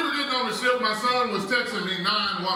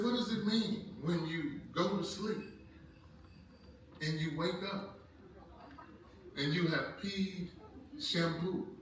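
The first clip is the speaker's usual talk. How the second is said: soft, slow, halting question; hesitant son; 3 semitones lower, 14 dB quieter, slower than usual. Retold or imitated. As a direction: imitate an embarrassed boy easing into a question. Son hesitantly asks embarrassing medical question.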